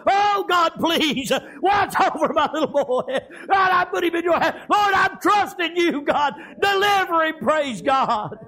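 high, wailing acted plea to God; preacher acting out a tearful plea; weeping prayer. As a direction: cry out as a desperate praying mother. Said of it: "weeping and crying over that little baby".

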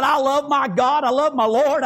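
usual speaking voice

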